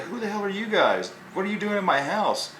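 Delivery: high, quick mock indignation; indignant demand. Homeowner confronting strangers in his house, mock indignant. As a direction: imitate a startled, indignant homeowner.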